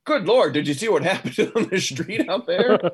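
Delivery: character's regular voice. Acted. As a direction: exclaim in character, laughing midway through. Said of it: "I guess in his regular voice".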